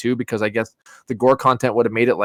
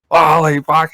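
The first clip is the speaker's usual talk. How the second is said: higher-pitched acted alarm; mock shock; 5 semitones higher, 4 dB louder, slower than usual. Victim seeing the body next morning, mock shock. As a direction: play a horror victim's stunned outburst.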